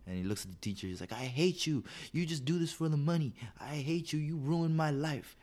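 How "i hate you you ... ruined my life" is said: put-on high, slow accusing kid; whiny kid voice. Kid to his teacher, accusing him, high slow whine. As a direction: spit it out high like a betrayed third-grader.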